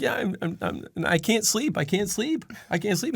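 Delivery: high, repetitive mock lament; hammed-up pleading complaint; distressed dad. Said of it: "And he's like".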